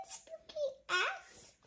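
ordinary delivery

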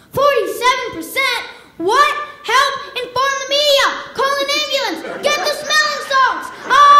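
mock grandma panic, rising to a shriek; grandma panics over iPad battery, mock frantic; freaking out. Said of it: "she freaks out".

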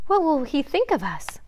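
high-pitched, worried plea; beg, with the pitch lifted; begging. A narrator's reading. Bambi fretting over the stag's opinion, begging. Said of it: "Bambi begged".

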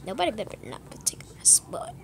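quick hushed whisper. Real, not acted. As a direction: whisper it from a hiding spot. Hiding, hoping not to be found.

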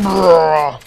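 usual speaking voice

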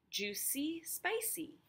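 "Juicy, spicy" climbing high, playful; sing-song rhyme. Shown as a demonstration. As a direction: bounce up on "spicy". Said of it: "So C is that last syllable".

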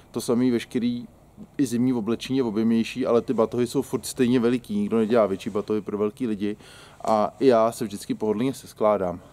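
normal talking voice

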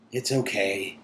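ordinary delivery

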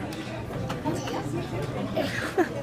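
silent wheezy laughter; breathy laugh. Real, not acted. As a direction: laugh almost without voice. Laughing at kid with lobster.

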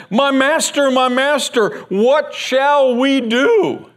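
high, slow, frantic cry of my master; a frightened servant begging his master for help; panicked servant. Acted. Terrified servant crying out to his master, high and slow.

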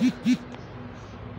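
short airy laugh up high; breathy laugh. Real, not acted. Laughing at a nonsense comment, airy laugh. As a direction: let a disbelieving laugh slip out.

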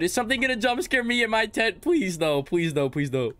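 high, fearful pleading; scared horror game streamer. Real, not acted. Alone at tent in horror game.

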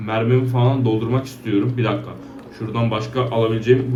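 usual speaking voice

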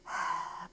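soft, breathy sigh; weary sigh. Acted. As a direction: let out a quiet sigh of impatience. Reader sighs as impatient sheep.

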